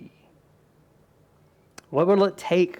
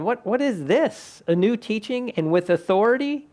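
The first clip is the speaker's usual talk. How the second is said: astonished crowd; 7 semitones higher than usual. Retold or imitated. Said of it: "In one twenty-seven".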